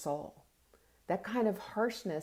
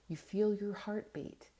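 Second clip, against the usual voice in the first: normal talking voice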